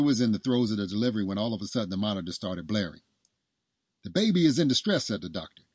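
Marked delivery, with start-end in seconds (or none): 4.0-5.1 raised, high-pitched urgent call; urgent announcement. A narrator's reading. Doctor warning the room during delivery, urgent.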